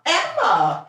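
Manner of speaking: high, loud name call; sharp call. Real, not acted. Owner calling her dog off the food, sharp high call. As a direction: snap the dog's name to stop her.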